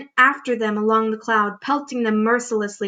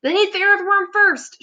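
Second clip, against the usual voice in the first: much higher, pushy mock shout; centipede shouting; 10 semitones higher than usual. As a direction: shout to save your own skin. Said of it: "shouted the centipede".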